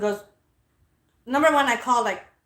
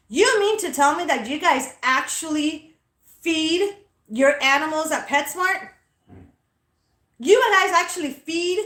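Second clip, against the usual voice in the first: higher and louder mock disbelief, stretched out; said out loud for others; 6 semitones higher, 4 dB louder than usual. Mocking the pet store clerk loudly in front of customers. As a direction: broadcast it so other customers hear. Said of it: "I said it out loud, like, for other customers to hear".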